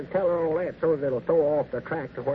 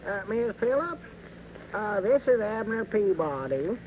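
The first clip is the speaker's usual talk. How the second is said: phone greeting; 7 semitones higher than usual. Acted. Abner starts phone call, hesitant.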